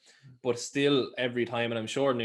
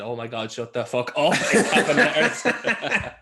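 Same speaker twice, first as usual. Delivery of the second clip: reading a reply while cracking up; laughing through a quote; 2 semitones higher, 8 dB louder, slower than usual. Retold or imitated. Reading out a meme reply to a friend, laughing. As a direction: let the laugh break the words.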